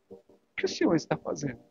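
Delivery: quick, soft, near-whispered plea to God; softly pleading prayer; hushed tearful prayer. Retold or imitated. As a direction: murmur it fast, as if close to tears. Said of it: "Eu voltei do trabalho chorando".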